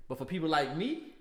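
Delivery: usual speaking voice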